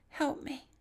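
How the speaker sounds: retold faint plea. Imitating friend's voice over the loudspeaker, faint plea. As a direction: imitate a faint voice through a speaker.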